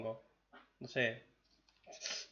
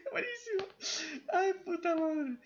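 squeaky, much higher, laughing disbelief; risa incrédula. Real, not acted. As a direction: squeal it out, can't believe it. Reacting to the comedic scene. (incredulidad aguda entre risas)

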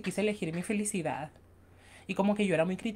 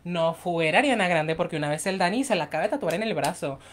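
ordinary delivery

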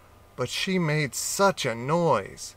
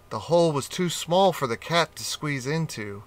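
ordinary delivery

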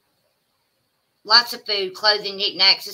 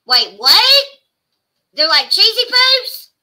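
surprised; 10 semitones higher, 8 dB louder, slower than usual. Real, not acted. Reacting to chat claim.

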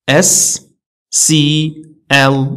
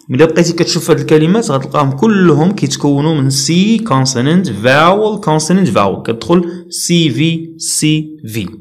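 ordinary talk

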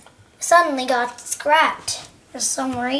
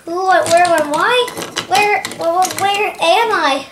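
bewildered; 3 semitones higher, 4 dB louder than usual. Acted. Thomas waking up with no memory, bewildered questioning. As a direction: blurt questions as if just come to.

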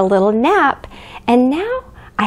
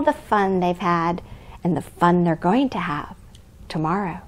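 softer and softer, lower; fading to soft; 6 semitones lower, 9 dB quieter than usual. Acted. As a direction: let it fade into sleep. Bedtime ending of story.

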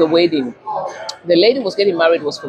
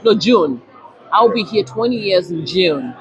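ordinary delivery

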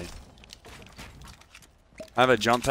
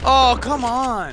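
slow, high, drawn-out complaint; frustrated groan; 11 semitones higher, 6 dB louder, much slower than usual. Real, not acted. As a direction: stretch it out in exasperation. Reacting to bad moment.

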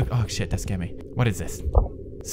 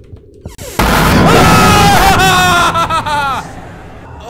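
loud, high-pitched scream tailing into stutters; piercing, sustained real shriek; long startled scream; 10 dB louder than usual. Real, not acted. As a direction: scream at a jump scare. Jump scare in the game.

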